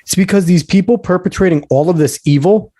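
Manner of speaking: normal talking voice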